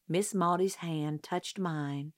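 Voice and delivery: usual speaking voice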